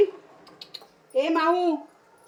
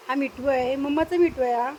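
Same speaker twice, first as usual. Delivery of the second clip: usual speaking voice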